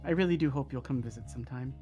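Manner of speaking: soft, gentle farewell; tender. Acted. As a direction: say it gently to your child. Ryuji's parting words.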